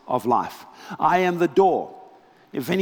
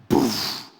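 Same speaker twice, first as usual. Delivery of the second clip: breathy poof; 5 semitones lower than usual. Retold or imitated. Power knocks soldiers down.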